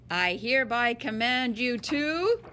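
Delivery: ordinary delivery, nothing marked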